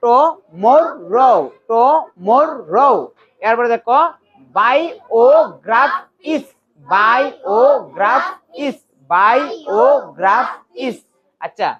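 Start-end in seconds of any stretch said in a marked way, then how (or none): 0.0-2.9 very slow "টমরো" in three beats; each syllable of "টমরো" drawn out. Shown as a demonstration. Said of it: "syllable করে করে পড়ো". 4.6-11.0 slow "বায়োগ্রাফিস" in four beats; each syllable of "বায়োগ্রাফিস" held apart. Shown as a demonstration. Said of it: "syllable করে করে পড়ো".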